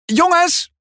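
high call across the room. A narrator's reading. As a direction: call the boys over, excited. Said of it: "roept Tess dan". (Tess roept de jongens hoog en opgewonden)

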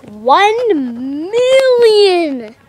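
big swooping announcement; excited milestone announcement. Real, not acted. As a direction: stretch out the big number with glee. Announcing dream subscriber milestones.

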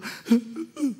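put-on a child's sharp crying gasp; sobbing gasp. Pastor voicing the crying girl at the altar, a sharp sobbing gasp. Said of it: "Pasa ahí llorando, pero a moco tendido".

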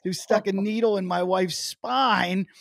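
loud, high, drawn-out protest; indignant outburst. Retold or imitated. Husband berating doctor over his eye roll, indignant outburst. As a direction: tell him off at full volume, slowing to lean on the words.

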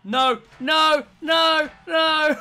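loud, high repeated cries of no; anguished shouts. Real, not acted. Player watching the rival escape, despairing shout. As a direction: yell in disbelief as the chance slips.

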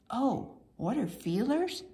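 ordinary delivery, nothing marked

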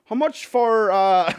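mock caller voice. Friend ringing escorts for prices.

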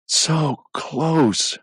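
panting near-miss sigh; gasped. Acted. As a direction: gasp it out, winded and rueful. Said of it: "Tyler gasped".

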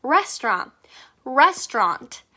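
restaurant slowly with the final T left out; restaurant, T dropped. Shown as a demonstration. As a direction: drop the T off restaurant. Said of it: "T를 팍 과감히 빼주세요".

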